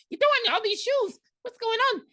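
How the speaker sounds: a baffled little creature asking; mock leprechaun. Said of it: "He's like".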